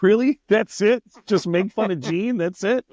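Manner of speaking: delighted the gig is that easy; excited disbelief. Retold or imitated.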